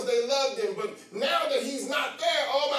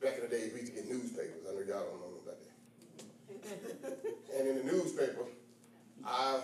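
low, hushed, quick anecdote; soft storytelling; 12 semitones lower, 7 dB quieter, faster than usual. Acted. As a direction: chat quietly like a memory.

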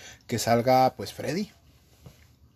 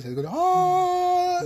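squeaky imitated flush noise; slower than usual. Imitating toilet squeak sound.

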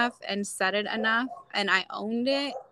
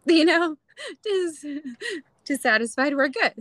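laughing aside; 7 semitones higher, 4 dB louder than usual. Real, not acted. Joking aside about husband.

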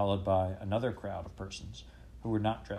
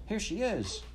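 excited call; 11 semitones higher, slower than usual. A narrator's reading. Boy spots queen, calls out.

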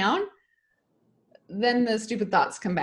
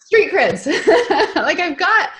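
laughing; 8 semitones higher, 8 dB louder, much slower than usual. Real, not acted. Laughing at her own slang.

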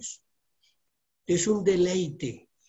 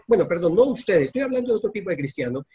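ordinary talk

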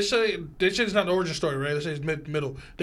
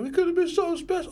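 character impression; 11 semitones higher than usual. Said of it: "Oh, he's like".

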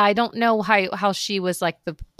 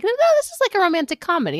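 pitched way up on the objection, then easing down; mock objection, starting very high; high-pitched retold protest; 9 semitones higher, much faster than usual. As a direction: protest in a high voice, quickly settling. Retold objection to "romantic drama.

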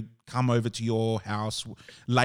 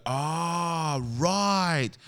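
drawn-out, higher 'ah, right'; imitating dawning realisation; 4 semitones higher than usual. Friend finally understanding the threat, imitated realisation. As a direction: let the penny drop slowly.

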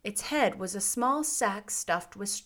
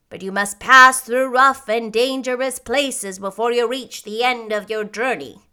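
louder, solemn warning; grave; 8 dB louder than usual. A narrator's reading. Munchkin warning the girl about the dangerous road, grave. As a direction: warn with grave weight. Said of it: "answered Boq gravely".

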